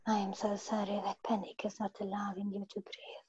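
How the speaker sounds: soft whispered repeat. Real, not acted. Client repeating the healer's words in a whisper.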